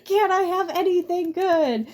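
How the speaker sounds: hammed-up furious yell; mock furious outburst. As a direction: rant like a man losing his mind. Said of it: "He's like".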